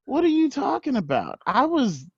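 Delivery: high, indignant What; squeaky mock outrage; high shocked denial. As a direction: play innocent up high. Accused of lying.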